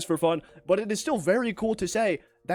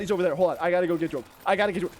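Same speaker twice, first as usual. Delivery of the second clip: normal talking voice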